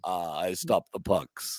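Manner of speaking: a bad Dracula, dragging each word; Dracula impression. Goalie in a cape, as Dracula, low and slow. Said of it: "came across a little bit like Dracula".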